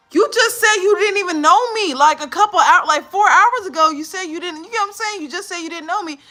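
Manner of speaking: high, loud, incredulous protest; indignant confrontation. Retold or imitated. Storyteller confronting shelter girl who forgot her, high loud indignation. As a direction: throw it back at her in loud disbelief.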